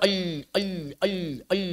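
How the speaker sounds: "el" softly, not pressed; soft light. Shown as a demonstration. Teacher showing a lam not pressed hard. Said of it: "Jangan ditekan kuat-kuat".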